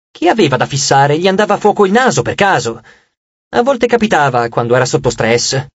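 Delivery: ordinary delivery, nothing marked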